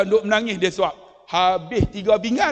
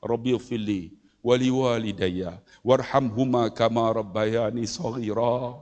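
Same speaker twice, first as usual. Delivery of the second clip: low, softer Arabic supplication; lowered reverent recitation, slowing to a close; recited prayer; 8 semitones lower, 3 dB quieter than usual. Shown as a demonstration. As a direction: recite the dua solemnly, easing off at the end. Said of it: "jangan lupa doa ini".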